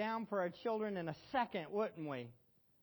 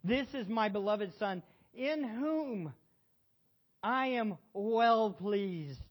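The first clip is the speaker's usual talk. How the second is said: solemn scripture reading; 4 semitones higher, 7 dB louder, slower than usual. Acted. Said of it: "Look at it".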